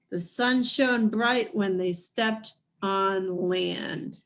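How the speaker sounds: ordinary delivery, nothing marked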